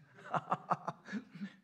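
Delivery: real chuckle; short run of breathy ha-ha laughter. Real, not acted. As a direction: laugh lightly after teasing the congregation. Laughs after remark to congregation.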